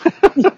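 sudden high burst of laughter; loud burst of laughter. Real, not acted. Laughing at own bad translation.

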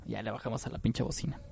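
ordinary delivery

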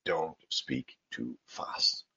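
slow whisper on "don't speak too fast"; confiding whisper; whispered key advice. Shown as a demonstration. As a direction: lean in and whisper the rule. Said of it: "if you want to sound better".

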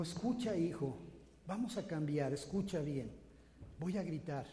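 soft, low, hushed instruction; a father calmly explaining to his son; gentle father. Retold or imitated. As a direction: quietly teach your boy.

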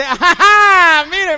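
very loud, high, drawn-out "aha"; gloating discovery. Retold or imitated. Robber finding the money in the purse, loud gloating cry. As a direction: burst out triumphantly at the find, hammed up.

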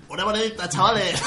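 torrentine street beggar asking for change; mild Torrente-style beggar voice. Retold or imitated. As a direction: wheedle a coin out of the lads. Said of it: "tiene la voz de".

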